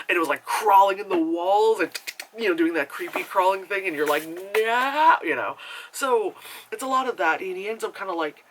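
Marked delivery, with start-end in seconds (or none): 4.3-5.2 drawn-out, rising squeal of protest; creeped-out cry. Retold or imitated. Viewer watching creepy crawling in the walls, mock horror. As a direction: stretch it out loudly, recoiling.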